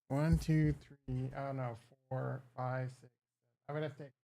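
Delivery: counting under the breath, very soft; muttered counting. Real, not acted. Working out days off to himself, muttered counting. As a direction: mutter it to yourself.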